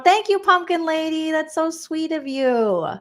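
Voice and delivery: high, sing-out thank-you, stretched at the end; gushing thanks. Real, not acted. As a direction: gush sweetly to a kind fan. Thanking a viewer for a gift.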